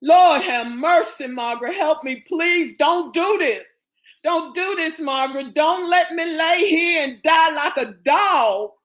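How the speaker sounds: high-pitched, anguished begging; desperate pleading. Acted. Wounded brother begging Margaret not to let him die, pleading. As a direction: beg desperately for your life. Thomas begs for his life.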